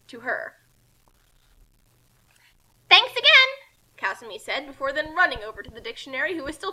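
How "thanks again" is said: high, loud thanks; bright thanks. Acted. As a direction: call out thanks cheerfully. Kasumi thanks Kiyoshi.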